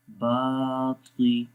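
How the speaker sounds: बाती with the बा drawn out long, slowly; long baa. Shown as a demonstration. Teacher drawing out the vowel for students. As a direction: stretch the बा in बाती. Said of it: "बा को लंबा करेंगे".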